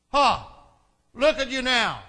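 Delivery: loud, high mocking jeer; shouted abuse. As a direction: jeer it out at someone below you. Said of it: "the people passing by shouted abuse".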